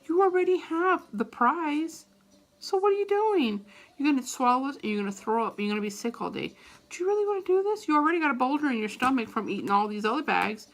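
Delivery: nagging conscience voice; scolding inner voice. Retold or imitated. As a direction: berate yourself mid-chew.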